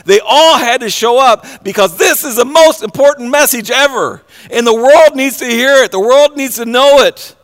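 loud, high, fervent preaching; preaching at full force. Acted. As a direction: lift it up and proclaim it to the room.

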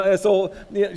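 ordinary talk

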